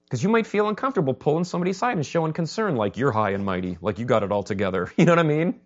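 ordinary talk

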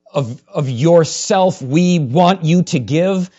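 loud, emphatic pulpit vow; forceful preaching. Real, not acted. Preacher making no apologies to his church, forceful and emphatic. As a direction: hammer out each phrase to the church.

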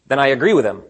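normal talking voice

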